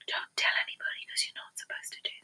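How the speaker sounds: hushed aside, barely voiced; conspiratorial whisper. Real, not acted. Telling viewers a naughty secret about the ashes, whispered. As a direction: whisper it like a secret you shouldn't share.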